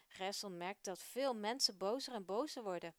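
usual speaking voice

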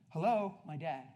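ordinary talk

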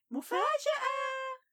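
high, slow joyful yell; surprise shout. Acted. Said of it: "صاح الجميع".

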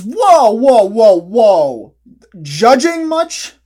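loud, high indignant yelling; outraged shout. Acted. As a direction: shout it in mock offense. Mock outrage at a glasses joke.

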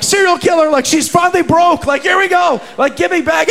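high, loud panicked yelling; hammed-up terror at the toll booth; mock panic. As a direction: shriek it like you're about to be murdered. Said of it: "I'm freaking out".

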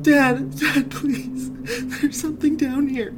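very high, sobbing terrified plea; crying child. A narrator's reading. Child in the basement crying for his father. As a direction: cry out for dad in panic.

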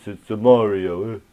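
put-on slow, loud Italian New York accent; Stallone Italian accent. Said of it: "something like this".

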